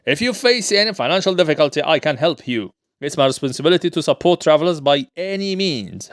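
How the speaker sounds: normal talking voice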